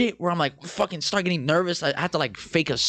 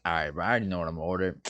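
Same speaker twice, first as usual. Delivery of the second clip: cocky; 10 semitones lower, 6 dB quieter, much faster than usual. Retold or imitated. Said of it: "I'm cocky".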